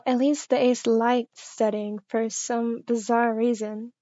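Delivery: ordinary delivery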